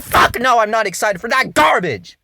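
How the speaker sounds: loud scoffing no; annoyed retort. Retold or imitated. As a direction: shut the fan down hard. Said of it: "And I'm like".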